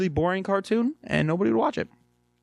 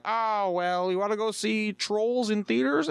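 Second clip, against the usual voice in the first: lightly voiced parent, pitched up; mock parental subject-changing, in a higher voice; imitated evasive parent; 7 semitones higher than usual. Parent changes the subject for a kid, mock higher voice. Parent dodging kid's question.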